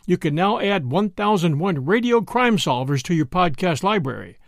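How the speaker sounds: normal talking voice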